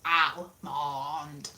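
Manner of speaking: Almond with a flat AL and odd MAHND ending; Almond as AL-mahnd, deliberately off; weird almond. Shown as a demonstration. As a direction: try out AL-mahnd, sounding wrong. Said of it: "Not like that. That sounds weird".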